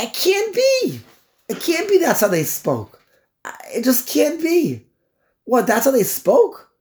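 high, straining disbelief; incredulous protest. Real, not acted. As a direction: refuse to believe it, pitch jumping up. Rejecting student's explanation in disbelief.

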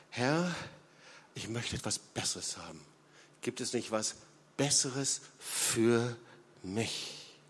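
hushed, longing plea to God; soft pleading prayer. Retold or imitated. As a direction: quietly beg for something better, the voice dropping low. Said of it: "dieser Schrei". (leises, sehnsüchtiges Flehen zu Gott)

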